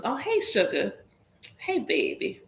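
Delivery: high, sugary Southern greeting; Southern sweet-talk. Shown as a demonstration. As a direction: lilt it like an unintended Southern flirt. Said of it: "And I'm Southern. So then it just comes".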